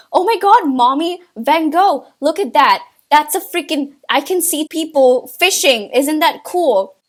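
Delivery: ordinary talk, nothing marked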